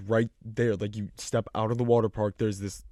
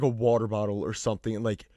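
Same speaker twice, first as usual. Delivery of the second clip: ordinary talk, nothing marked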